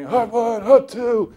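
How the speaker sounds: loud, high quarterback cadence; mock quarterback at the line shouting hut; calling signals. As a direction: bark out the snap count.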